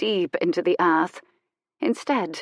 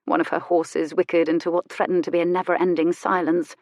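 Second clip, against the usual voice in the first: ordinary delivery, nothing marked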